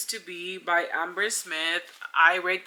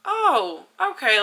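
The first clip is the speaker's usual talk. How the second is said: mock surprise; 7 semitones higher, 6 dB louder, slower than usual. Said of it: "And I'm just like".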